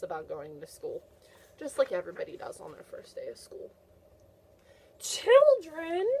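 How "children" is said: much higher, louder mock-shocked cry; dramatic reveal. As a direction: exclaim it like a big surprise. Dramatic reveal in picture book.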